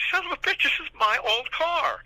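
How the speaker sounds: stunned disbelief, spoken to himself; shocked thought. Retold or imitated. Spotting his old car for sale online, shocked and high. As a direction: pitch jumps way up, slowed on the last words. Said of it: "And I'm thinking".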